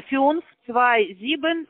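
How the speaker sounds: usual speaking voice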